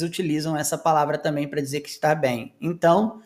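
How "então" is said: usual speaking voice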